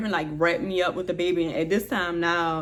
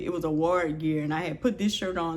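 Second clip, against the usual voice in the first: ordinary talk, nothing marked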